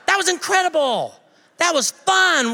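high-pitched, thrilled exclaiming; so excited. Retold or imitated. Man returning to his family after serving, excited. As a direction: gush to the family, bursting with excitement. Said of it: "I'm so excited".

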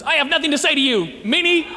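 mock playground whine; whiny name-caller. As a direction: sulk it like a kid losing an argument. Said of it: "What that actually says in real world terms is".

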